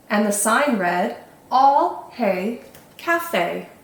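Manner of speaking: measured, melodic storybook narration; children's story reading. A narrator's reading. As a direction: tell the tale to little ones, phrase by phrase.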